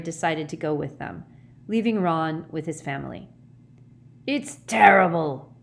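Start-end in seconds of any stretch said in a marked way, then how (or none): 4.3-5.5 slow, loud growl. A narrator's reading. Hagrid leaving the hospital ward, loud upset growl. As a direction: growl it heavily into a beard. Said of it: "growled Hagrid into his beard".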